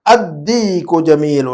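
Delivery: very slow Arabic with long vowels; model reading. Shown as a demonstration. Teacher modelling the sentence for a pupil, slow drawn-out reading. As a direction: stretch it out, doubling the د. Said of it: "زبان شامل ہے اس میں".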